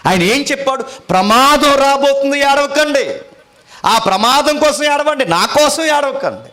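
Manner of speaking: high, loud, urgent preaching call; fiery preaching. Acted. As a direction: thunder the warning at the congregation. Preacher cries out the warning. (పెద్ద గొంతుతో ఆవేశంగా ప్రసంగం)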